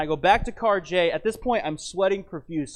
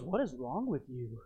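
imitating his wife; 4 semitones lower, 9 dB quieter than usual. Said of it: "And she goes".

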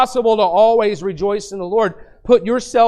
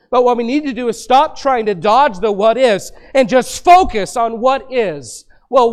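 louder, driving sermon rhythm, each "What is?" punched out; preaching at full force; 3 semitones higher, 4 dB louder than usual. Acted. Preacher rallying the congregation against worry, loud and fervent. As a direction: build the fire, fire the question, answer it strong. Rising preaching call and response.

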